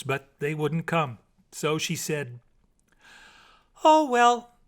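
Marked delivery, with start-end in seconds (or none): 3.8-4.6 high, loud, bright-spirited "Oh, well"; cheerful mermaid. A narrator's reading.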